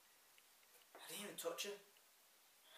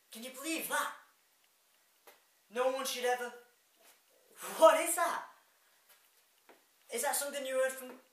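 higher, louder indignant protest; incredulous outrage; 3 semitones higher, 7 dB louder than usual. Acted. Rejected lover throws her words back, indignant and loud.

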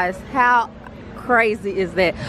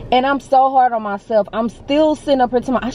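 ordinary delivery

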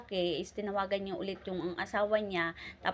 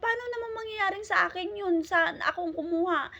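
shocked protest; 10 semitones higher, 4 dB louder than usual. Retold or imitated. Maid shocked at being accused.

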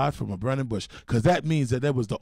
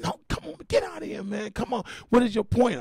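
much higher, pained whine; exasperated whine; 8 semitones higher than usual. Retold or imitated. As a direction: beg him to stop, hurting. Man in pain, exasperated.

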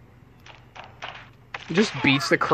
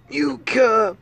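calling out; 11 semitones higher, 5 dB louder than usual. Acted. Calling character name.

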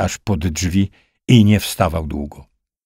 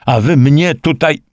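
high, agitated, cut-off outburst; desperate protest; 7 semitones higher, 4 dB louder than usual. A narrator's reading. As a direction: protest urgently in a raised voice. Breathless captain protests, cut off. (wzburzony, urwany protest wysokim głosem)

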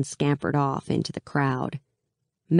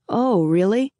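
higher, louder playful lilt; teasing; 6 semitones higher, 5 dB louder than usual. A narrator's reading. Mal teasing Alina, amused and playful. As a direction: tease her with mock interest.

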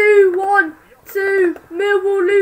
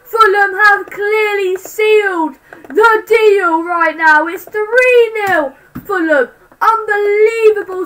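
excited report; 5 semitones higher, 5 dB louder, slower than usual. Real, not acted. Reporting the goal still excited.

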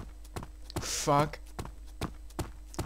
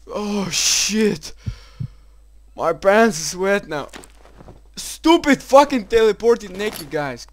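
loud, higher rattled cursing; shaken shout; 5 semitones higher, 9 dB louder, slower than usual. Real, not acted. Player still shaken by the naked monsters, shouts curses. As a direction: rant loudly while still rattled.

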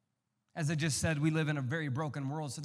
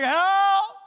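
high, loud mock child yell; mock cry for help; 15 dB louder than usual. Little kid in the photo crying out, high and loud. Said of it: "Noggs is like".

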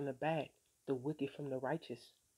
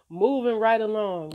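dismissive brush-off; 6 semitones higher, 13 dB louder than usual. Retold or imitated. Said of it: "Now I'm just like".

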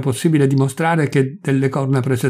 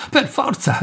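crying out; 12 semitones higher than usual. A narrator's reading. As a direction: cry it out high, with restrained volume. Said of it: "gridò il Corsi".

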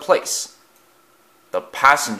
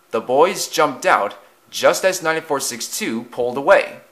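ordinary delivery, nothing marked

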